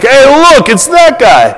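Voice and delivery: high-pitched, excited pointing-out; mock excitement. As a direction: call everyone's attention to it, hammed-up and high. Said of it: "Unless you're really excited".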